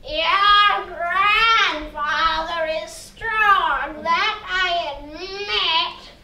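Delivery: very slow, drawn-out spooky sprite; water sprite voice. Acted. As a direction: stretch every word like a lake spirit.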